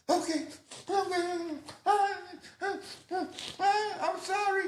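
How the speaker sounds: high, broken sobbing apology; mock sniffling. Teen hit by police, still sniffling and apologizing. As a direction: blubber it haltingly, gasping between words. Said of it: "but I'm still sniffling".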